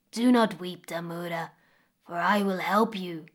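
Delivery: gentle, kind crocodile; smiling. Acted. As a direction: comfort her with a smile. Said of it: "smiled the crocodile".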